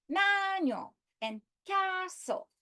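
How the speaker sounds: MAN of "manual" and CAS of "castle" high and drawn out; stress on first syllables. Shown as a demonstration. Teacher letting students hear the pitch, first syllables of "manual" and "castle" stressed high. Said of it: "You hear the pitch".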